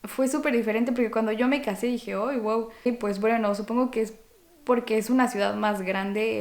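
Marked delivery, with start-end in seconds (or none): none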